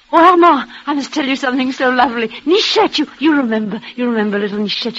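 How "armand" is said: joyful call. Acted. Calls out to Armand joyfully.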